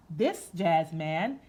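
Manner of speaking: ordinary delivery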